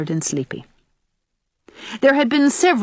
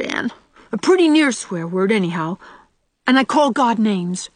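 put-on high, louder little-boy blurt; confession in a rush; 7 semitones higher, 4 dB louder than usual. A narrator's reading. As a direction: blurt out a child's guilty confession. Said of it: "Out it all came in a rush".

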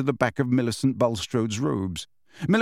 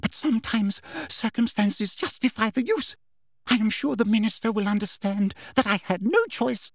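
higher, sugary false regret; Umbridge's voice; 8 semitones higher than usual. A narrator's reading. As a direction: feign reluctance, prim and high. Umbridge justifies herself.

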